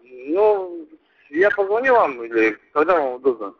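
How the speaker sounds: ordinary talk, nothing marked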